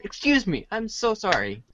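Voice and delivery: normal talking voice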